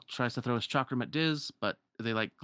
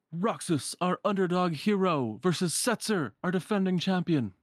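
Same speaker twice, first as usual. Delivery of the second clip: high, projected announcer voice; showy match-announcer call; event announcer; 7 semitones higher, 3 dB louder than usual. Acted. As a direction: introduce the fighters to the crowd. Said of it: "Then the announcer".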